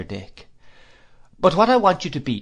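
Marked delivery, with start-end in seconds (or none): 1.4-2.4 put-on higher, brisk and decided old aunt; stern aunt. A narrator's reading. Aunt advising her nephew, brisk and decided. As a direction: lay down the law kindly but firmly.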